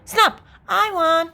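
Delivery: high, drawn-out victory call; triumphant little sister. A narrator's reading. Little sister calls Snap on brother, triumphant. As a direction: call it out gleefully, slowly.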